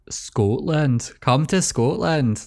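put-on Scottish accent as Americans do it; an American's exaggerated attempt at Scottish; American mock-Scottish accent. Shown as a demonstration. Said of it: "that's what the Americans do".

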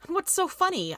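indignant "what's so funny", pitched high; angrily. A narrator's reading. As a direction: demand it, stung and angry. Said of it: "I asked angrily".

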